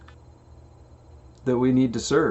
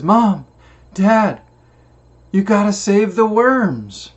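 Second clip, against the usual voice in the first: put-on high, loud childlike plea; pleading child; 8 semitones higher, 7 dB louder, slower than usual. Little daughter begs Mom and Dad at the bus, high loud pleading child voice. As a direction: beg like a little girl saving worms.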